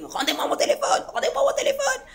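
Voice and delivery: retold yelling. Demanding her phone back from police, laughing mock yell. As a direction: replay the screaming with a laugh. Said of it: "le chef me voit en train de hurler".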